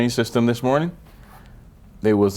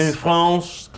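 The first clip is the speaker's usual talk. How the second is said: higher, mock French pronunciation; French accent; 5 semitones higher than usual. Echoing France in mock French.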